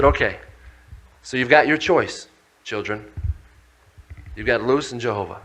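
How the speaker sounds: low, soft fatherly voice; gentle father. Retold or imitated. Father telling his children they are loved, gently. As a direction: speak tenderly to your children.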